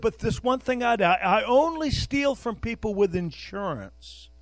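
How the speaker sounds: quoting the thief with a high, self-satisfied lilt; mock thief. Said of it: "one guy was a thief".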